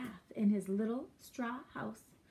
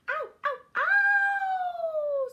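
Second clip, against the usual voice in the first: very high yelps of pain; cartoon wolf howling in pain; screamed ow; 8 dB louder, much slower than usual. A narrator's reading. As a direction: yelp twice, then a long loud scream. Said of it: "screamed the wolf".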